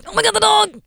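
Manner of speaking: frightened little hamster spotting the dog; panicked hamster voice. Retold or imitated. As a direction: voice the terrified hamster.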